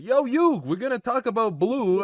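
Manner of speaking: Joey-style Brooklyn accent. Shown as a demonstration. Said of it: "it's going more like Joey, like, Brooklyn accent here".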